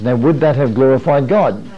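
ordinary talk, nothing marked

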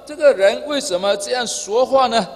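an emphatic, acted challenge; uncomfortable objection. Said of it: "他们真的很不舒服啊".

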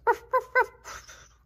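high, loud, slow ruffs; slow dog barks. A narrator's reading. Dog barking at birds, high and loud. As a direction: bark like a small dog at birds.

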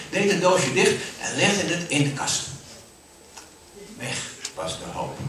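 plain reading voice

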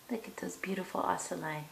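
whispering. Real, not acted. Admiring pet in a whisper.

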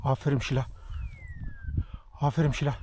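soft praise to a good dog; gently reward the dog; talking to a dog. Real, not acted. Softly praising dog Şila.

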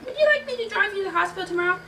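high, quick aunt; high-pitched helpful aunt; Aunt May. A narrator's reading. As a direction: raise the pitch and hurry the offer. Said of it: "Aunt May asked".

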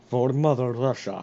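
slow, hammed-up Russian accent. Shown as a demonstration. Showing a friend how it's done, Russian accent. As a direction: proclaim it in a thick mock-Russian accent. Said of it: "You don't do a good Russian accent".

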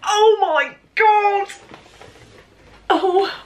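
high, slow squeal of delight; genuinely overwhelmed, stretched out and loud; thrilled cry. Real, not acted. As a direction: let it burst out as a real gasp of joy. Opening the gift box.

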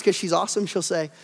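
normal talking voice

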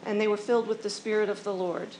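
ordinary delivery

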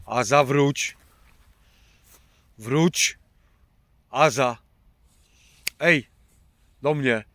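loud call, high and urgent; calling from far away. Real, not acted. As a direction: shout her name to bring her back. Calling runaway dog back.

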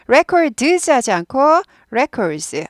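records said very slowly, the r curled back; slow contrast of records, flat r then curled r. Shown as a demonstration. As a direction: bend the tongue for the R in records. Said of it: "R이 있으니까 구부려요".

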